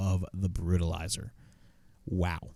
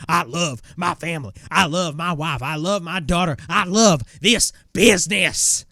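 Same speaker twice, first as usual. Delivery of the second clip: hammed-up mocking wrestler promo voice, higher and louder; Dax Harwood; 4 semitones higher, 6 dB louder than usual. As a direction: chant each declaration in an exaggerated put-on voice, building to the last. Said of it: "That's my little Dax Harwood impression".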